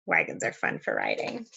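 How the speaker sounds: ordinary delivery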